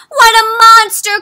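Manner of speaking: high, loud horrified shout; frightened cry. Acted. Villager shrieking about the dragon, a scared cry. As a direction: cry out at the monster. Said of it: "cries another".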